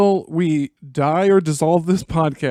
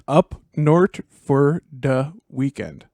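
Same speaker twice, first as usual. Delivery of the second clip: Minnesota accent, drawn out slowly; slow deliberate Minnesota accent demo; much slower than usual. Shown as a demonstration. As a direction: spell out the Minnesota phrase slowly. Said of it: "If you're trying to do a basic Minnesota accent".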